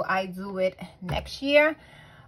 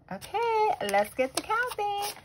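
perky; 12 semitones higher, 4 dB quieter than usual. Real, not acted. Perky start of money count.

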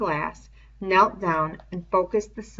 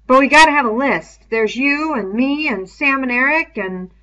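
put-on louder boy's voice, insistent; a boy pushing for a list; urgent young leader; Ralph's voice; 6 semitones higher, 9 dB louder than usual. Ralph's dialogue in a reading.

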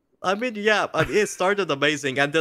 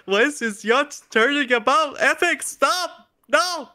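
rising high panicked yells at the screen; begging a departing boss, ever more shrill; desperate mock pleading; 9 semitones higher, 3 dB louder than usual. Gamer yelling at game character not to leave, mock panic.

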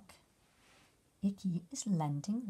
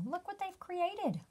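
amazed; 9 semitones higher than usual. Acted. Amazed reading to child.

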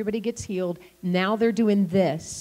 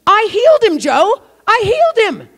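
high, loud overjoyed cry; excited shout; 12 semitones higher, 10 dB louder than usual. Retold or imitated. Young man telling the pastor he healed someone, excited shout. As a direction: shout it out in disbelief and joy.

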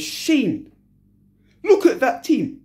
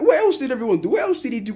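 normal talking voice

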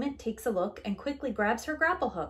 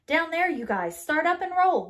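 calling out orders; 6 semitones higher, 6 dB louder than usual. Acted. Kid directing the rescue.

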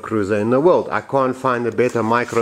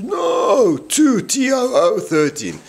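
much higher, slow insistent friend's voice; Yampa correcting; 11 semitones higher, slower than usual. Retold or imitated. French friend spelling the number, high and slow. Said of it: "And he says".

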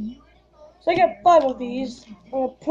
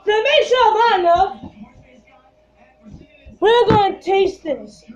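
raised, higher voice; loud; 7 semitones higher, 3 dB louder than usual. Real, not acted. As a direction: push it out loud and high. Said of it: "try to be loud".

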